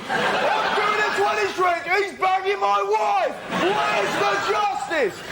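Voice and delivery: high-pitched wailing protest; aggrieved rant. Acted. Man complaining his clone has his wife, aggrieved rant.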